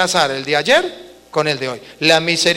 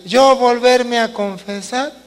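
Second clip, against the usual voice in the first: embarrassed penitent; 4 semitones higher than usual. Acted. Reluctant sinner balking at confessing the same sins, acted embarrassment. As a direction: voice someone too ashamed to confess. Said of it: "me da pena".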